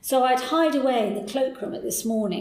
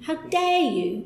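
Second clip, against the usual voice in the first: pitched higher in mock outrage; indignant; 6 semitones higher, slower than usual. To the editor who stole her story, higher indignant echo. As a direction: repeat it, rising in outrage. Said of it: "So I was absolutely furious".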